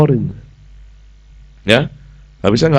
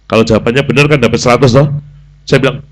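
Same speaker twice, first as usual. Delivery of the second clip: ordinary talk, nothing marked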